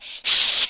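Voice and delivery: hissed imitation of a gremlin; hissing. Teller imitating L in the exam room, hissing. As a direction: hiss sharply at someone. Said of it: "he's, like, hissing at the guy".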